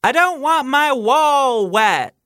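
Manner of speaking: high, slow, loud ghostly line. Retold or imitated. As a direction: intone it like a ghost. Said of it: "Is it C".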